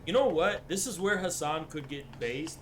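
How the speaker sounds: ordinary talk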